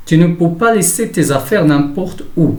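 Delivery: careful dictation pace, pitch lifted; example read-out. Shown as a demonstration. Teacher reads example sentence aloud.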